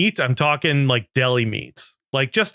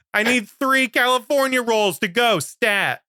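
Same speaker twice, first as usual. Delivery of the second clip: mock demanding customer; 8 semitones higher than usual. Customer yelling order at chef.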